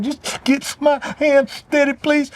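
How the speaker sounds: put-on high, halting child's plea, dragged out slowly; imitated stuttering child. Little girl begging her daddy to free her hand, stuttering. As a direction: imitate a crying child stammering through a plea. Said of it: "a child that starts stuttering when they're talking".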